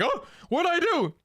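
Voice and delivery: confused. Retold or imitated. Streamer acting her confused reaction, high and baffled. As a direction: squeak it out, baffled.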